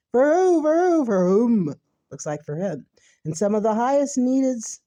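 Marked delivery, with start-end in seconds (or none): 0.1-1.7 loud sarcastic "For who, for whom?"; sarcastic outburst. Real, not acted. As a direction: jab at the charity's real beneficiary. Sarcastic loud mock question.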